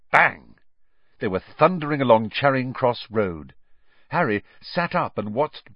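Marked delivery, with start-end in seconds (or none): 0.1-0.6 loud bang. A narrator's reading. Narrated loud sound.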